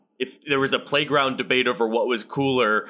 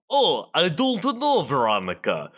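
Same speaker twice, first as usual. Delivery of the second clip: mock Russian accent, slightly raised pitch; Kermit-style Russian accent; 5 semitones higher than usual. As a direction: Kermit trying a Russian villain. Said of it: "You sound like, uh, Kermit the Frog in the Muppets Most".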